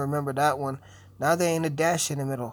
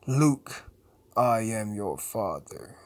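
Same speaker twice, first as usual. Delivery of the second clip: slow, drawn-out villain line; dramatic, weighty film quote; 4 semitones lower, slower than usual. Acted. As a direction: intone it slowly like a movie villain. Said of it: "one of the most famous phrases".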